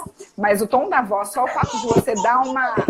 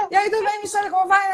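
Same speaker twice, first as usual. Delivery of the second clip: higher, brisk upbeat greeting; energetic sales voice; 9 semitones higher, much faster than usual. Shown as a demonstration. Doctor greeting the sales team, in an energetic voice. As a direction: greet with punch like a seller. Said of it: "A energia da venda precisa de quê".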